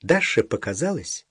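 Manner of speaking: plain reading voice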